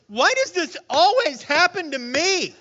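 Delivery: high, loud self-pitying question; mock the complaint; exasperated whine. Preacher voicing a listener's self-pitying complaint, mock whine. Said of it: "ask yourself the question".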